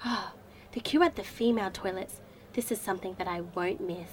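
low grumble to herself; quiet weary grumble; muttered aside. Acted. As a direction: sigh and grumble under the breath.

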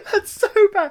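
high, loud groan of protest through laughter; laughing outrage. Real, not acted. Reacting to a terrible pickup line, laughing in outrage. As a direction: barely get it out for laughing.

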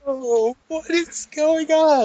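real baffled disbelief; incredulous. Real, not acted. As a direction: sound like your mind is slipping. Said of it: "I'm losing my mind".

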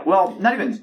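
usual speaking voice

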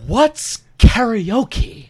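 slow, high, wide-eyed clueless question; drawn-out innocent confusion; naive newbie voice. Retold or imitated. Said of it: "'Cause that seems like the same voice you would have used".

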